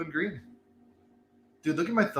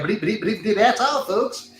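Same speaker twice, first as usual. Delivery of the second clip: stuttering cartoon pig, a bit higher; Porky Pig sign-off; 5 semitones higher than usual. Host quoting Looney Tunes ending, mild Porky stutter. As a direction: stammer the famous sign-off like Porky. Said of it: "like Porky Pig".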